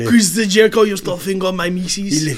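hammed-up French Canadian accent. Co-host mimicking wrestler's complaint about Jericho, mock French accent. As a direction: put on a comic French accent. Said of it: "speaks with a French accent".

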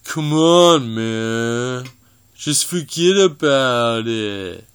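slowed, warped app voice; voice changer effect. Shown as a demonstration. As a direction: stretch it out like a warped recording. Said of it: "let me give it a try".

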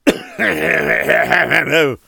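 choking cough in the wind; hacking coughs from the dust; loud cough. Acted. Wind blows dirt.

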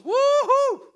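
loud, high swooping whoop; mock angelic arrival call; high whooped "woo-hoo". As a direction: burst in with a sudden high hoot. Imitates the angel's sudden arrival.

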